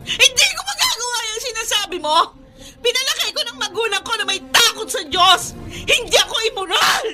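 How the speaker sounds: crying out her innocence through tears; wounded, tearful denial; tearful protest. Acted. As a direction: plead through sobs, voice straining. Wife denies accusation, tearful.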